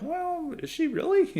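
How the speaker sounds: high, squeaky mock disbelief; incredulous doubt. Voter doubting Nikki Haley is Indian, squeaky incredulous. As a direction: ask it as if you can't quite believe it. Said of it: "you're like".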